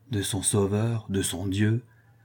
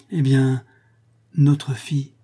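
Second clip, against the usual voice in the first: usual speaking voice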